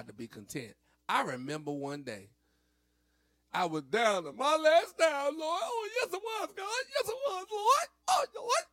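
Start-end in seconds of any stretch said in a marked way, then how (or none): 3.5-8.6 very high, loud whooping preacher; preacher caught up. Shown as a demonstration. To the viewers, demoing a very high caught-up preacher. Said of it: "when they used to get caught up like this".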